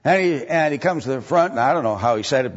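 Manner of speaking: ordinary delivery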